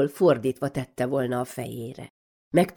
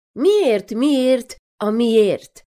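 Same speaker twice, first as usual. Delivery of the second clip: high, loud insistent whys; high loud childish why-chant; pestering child; 6 semitones higher, 6 dB louder, much slower than usual. Acted. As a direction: nag like a curious little kid.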